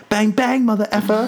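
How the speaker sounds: high-pitched mock Australian accent; imitated Australian accent. Host quoting the escaping monkey, imitated Australian accent. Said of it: "got this Australian type of accent".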